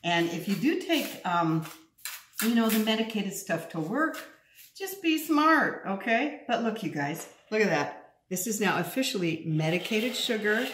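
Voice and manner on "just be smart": high, slow just be smart; emphatic advice. Real, not acted. Host warning viewers to be careful, slow and emphatic. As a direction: lean on each word, slowly. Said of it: "stressed advice, drawn out".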